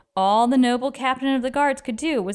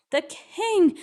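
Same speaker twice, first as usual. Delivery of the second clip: panting; 9 semitones higher than usual. A narrator's reading. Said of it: "panted Bartholomew".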